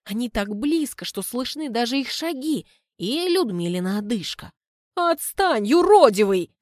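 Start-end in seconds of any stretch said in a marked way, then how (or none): 4.9-6.5 loud, higher, dismissive yell; brushing off shout. A narrator's reading. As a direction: wave him off loudly. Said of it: "Отмахивается она". (отмахивается криком)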